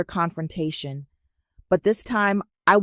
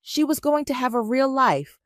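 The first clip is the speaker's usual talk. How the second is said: high-pitched, heated justification; voice rising; 7 semitones higher than usual. A narrator's reading. Aunt justifying herself to her niece, voice rising. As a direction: plead the case with the voice lifted. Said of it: "her voice rising".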